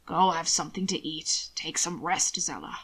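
low-pitched, slightly softened order; lightly voiced master. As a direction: gently dismiss a tired underling. Master sends tired man to rest.

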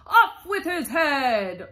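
high, loud royal yell; shouting. A narrator's reading. The Queen orders players executed, shouting. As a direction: bellow the command, drawing it out. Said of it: "arguing with the other players and shouting".

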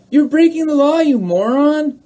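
high, loud rebuke; exasperated scolding. Acted. Mock rebuke to arrested judge.

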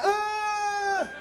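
high, held mock grunt; exaggerated grunt. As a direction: draw out a high "uh". Acts out noise during bawdy bit.

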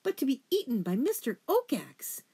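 high, indignant sputter. A narrator's reading. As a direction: sputter in outraged disbelief. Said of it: "Lungwort sputtered".

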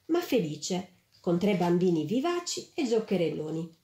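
ordinary delivery, nothing marked

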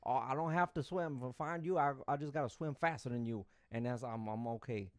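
folksy mock Cajun accent; Cajun guide. Cajun guide says he only cares about himself, Cajun accent. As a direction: tell it like a Cajun boatman. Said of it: "The whole time he was telling us".

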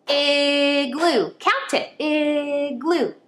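"Igloo" split into two clapped beats, IG-loo; clapping syllables. Shown as a demonstration. Teacher clapping the syllables of igloo for kids. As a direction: clap out "Igloo" slowly. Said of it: "let's clap igloo".

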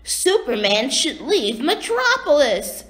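high-pitched defiant character voice; angry retort. A narrator's reading. Bizarro orders Superman out, high indignant retort.

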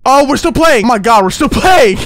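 high, loud disbelief; astonished shout. Real, not acted. Player finding the game still going, astonished shout. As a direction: shout it out, amazed.

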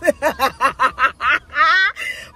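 loud rising burst of laughter; big real laugh; hearty laugh. Real, not acted. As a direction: laugh out at the memory. Laughs at own retold line.

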